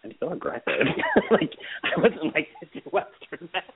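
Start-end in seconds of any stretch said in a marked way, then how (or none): 0.0-0.6 catching herself after road rage, mock shocked; mock self-dismay. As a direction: mutter at yourself, amused. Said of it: "I'm like".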